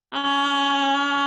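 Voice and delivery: loud sustained ah; projected ah. Shown as a demonstration. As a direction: hold ah and project it over the head. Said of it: "project your voice all the way over my head".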